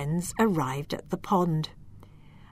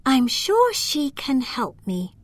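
higher, hopeful little voice; hopeful elf; 6 semitones higher than usual. Acted. Lila heading off to the magician, hopeful and high. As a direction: think it out hopefully. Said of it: "thought Lila".

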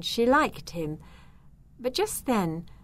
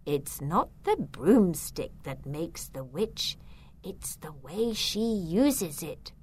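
softly voiced grandmother's advice; grandmother's saying; 3 dB quieter than usual. A narrator's reading. Zelda remembering her grandmother's advice, softly quoted. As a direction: recall it softly, slowing at the end. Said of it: "what her grandmother used to say".

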